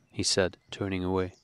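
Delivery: usual speaking voice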